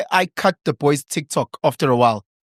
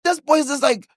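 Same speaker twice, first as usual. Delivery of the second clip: high mock tone; 9 semitones higher than usual. Retelling son's reaction.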